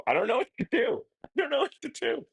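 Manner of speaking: giddy high-pitched meltdown; flustered panic. Real, not acted. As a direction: pitch shooting up into a squeal. Flustered by donations, losing composure.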